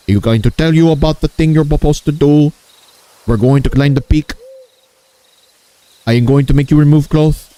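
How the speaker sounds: put-on high-pitched caricature Indian accent; exaggerated comic Indian accent; mock Indian accent. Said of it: "this is my Indian impression of Lois".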